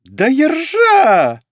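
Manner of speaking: slow, very high, loud squeal; voice jumping up. Acted. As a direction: let the voice leap up into a squeak. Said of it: "голос вдруг сбился на пару октав".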